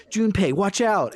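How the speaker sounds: high-pitched retold alarm; urgent warning. Fuka warns Junpei of the attack, urgent warning.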